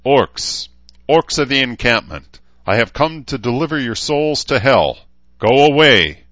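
high, loud battle call across a field; shouted challenge. Acted. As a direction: bellow it up at the gate. Knight shouts challenge at orcs.

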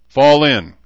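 high, loud drawn-out military call; called order. A narrator's reading. Gunny calls the troop to fall in, calling out. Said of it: "Gunny called quietly".

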